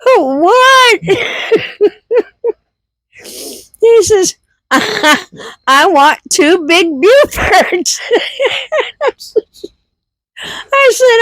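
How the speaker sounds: high and laughing, barely holding it together; giggling, pitch way up, words cracking. Real, not acted. Mom laughing while retelling the drive-thru order. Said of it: "I can't even tell it without laughing".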